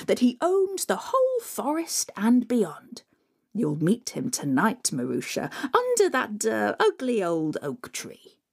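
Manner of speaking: scheming stepmother. Acted. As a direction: savour the cruel trick.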